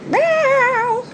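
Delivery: very high squeaky meow; cat meow. Retold or imitated. Imitating a cat.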